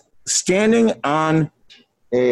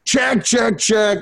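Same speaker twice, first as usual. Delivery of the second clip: "Check" thrown out higher in a mock yell; mimicked mic check scream; 7 semitones higher, slower than usual. Band member screaming his mic check at sound check. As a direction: ham up a singer screaming into the mic. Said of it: "like, screaming".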